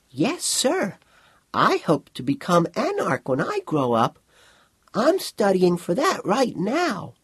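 put-on high child's voice, slowing at the end; earnest young boy. Boy telling Eng he hopes to become Anarch, high and earnest. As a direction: pitch it up like a polite, hopeful schoolboy.

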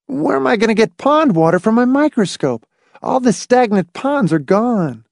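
put-on high-pitched boyish grievance; complaining boy. A narrator's reading. Boy missing his pond water, high complaining voice.

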